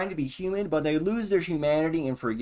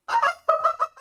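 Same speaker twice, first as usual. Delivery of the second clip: high laugh burst. Real, not acted. Laughing burst after swearing.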